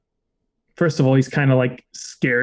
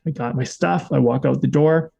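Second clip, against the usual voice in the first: normal talking voice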